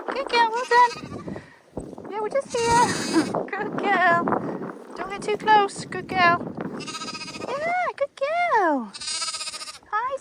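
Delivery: high-pitched reassuring dog talk; cooing to the dog. Real, not acted. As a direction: soothe the dog up high like talking to a pet. Praising the dog alongside the horse.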